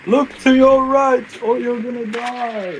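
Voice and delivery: high, slow taunting voice; drawn-out told-you-so; teasing sing-song. Real, not acted. Teasing teammate after death.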